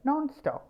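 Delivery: Non stop with Non hit high; stress on non. Shown as a demonstration. Teacher drilling Non stop for students, Non stressed. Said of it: "അവിടെ ഒരു stress ഉണ്ട്".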